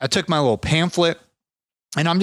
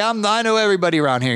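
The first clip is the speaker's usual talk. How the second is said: imitating old man; 7 semitones higher, faster than usual. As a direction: play the tired old neighbour. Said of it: "He's like".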